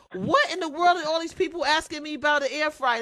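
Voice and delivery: high, loud, baffled exclamation; mock bewilderment. Host replaying her confusion at the flood of fans, baffled. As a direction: throw up your hands, utterly puzzled. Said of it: "I'm like".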